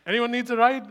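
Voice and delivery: loud, high call to passers-by; hammed-up cart driver's pitch; imitated driver's offer. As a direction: call out like a driver touting rides. Acts cart driver calling out offer.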